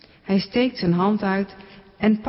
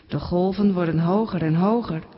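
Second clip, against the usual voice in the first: ordinary delivery, nothing marked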